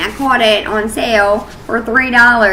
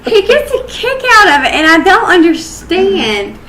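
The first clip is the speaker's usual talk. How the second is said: laughing; 10 semitones higher, 5 dB louder than usual. Real, not acted. Still laughing at husband.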